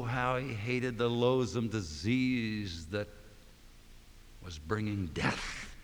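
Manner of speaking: ordinary talk, nothing marked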